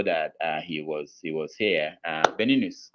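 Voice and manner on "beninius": ordinary delivery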